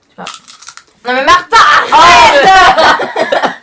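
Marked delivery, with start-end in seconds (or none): 1.1-3.5 loud laughing shout to stop; laughing protest. Real, not acted. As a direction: cry out through laughter. Protesting while laughing at friend.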